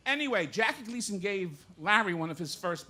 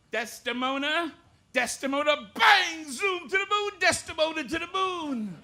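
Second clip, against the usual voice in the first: Gleason catchphrase shout; 7 semitones higher than usual. Retold or imitated. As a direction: blast it like a sitcom husband's threat. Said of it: "Jackie Gleason doing Shakespeare".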